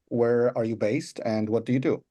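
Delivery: ordinary talk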